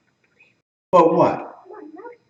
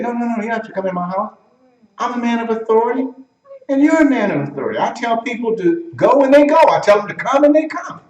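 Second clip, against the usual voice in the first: higher-pitched, quick, urgent plea; insistent centurion; 5 semitones higher, faster than usual. Acted. Centurion telling Jesus he need not come, insistent. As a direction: argue it earnestly, pitched up.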